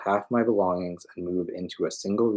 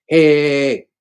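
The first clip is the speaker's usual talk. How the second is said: high, loud repeated hey; Tony chases her upstairs, loud calling; 9 semitones higher, 12 dB louder than usual. Acted. Urgent loud calling. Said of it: "Tony's voice calling out".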